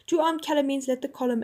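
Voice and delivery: ordinary delivery, nothing marked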